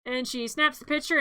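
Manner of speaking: normal talking voice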